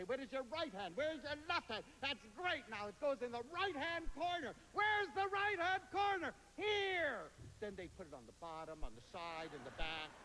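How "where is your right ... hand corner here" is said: lightly voiced patient teacher, higher and bright to kids; a hint of a second-grade teacher coaxing. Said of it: "I'd say".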